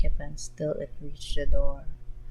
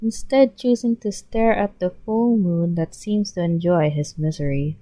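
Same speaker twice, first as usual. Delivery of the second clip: plain reading voice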